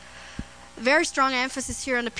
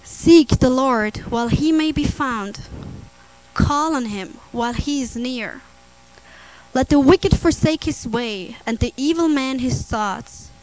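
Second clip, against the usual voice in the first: scripture proclamation; 6 dB louder than usual. Acted. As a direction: proclaim each phrase deliberately to the congregation. Reads Bible verse aloud.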